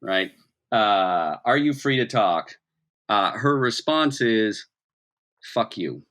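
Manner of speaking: ordinary delivery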